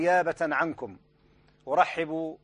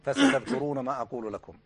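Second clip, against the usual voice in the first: higher quoted warning; 7 semitones higher than usual. Retold or imitated. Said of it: "في حلقة البارحة يا شيخ".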